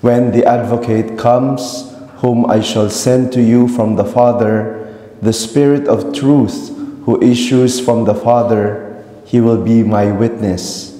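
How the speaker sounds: reverberant church acoustics; cathedral echo. Real, not acted. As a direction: voice ringing through a big church. Gospel read in resonant church.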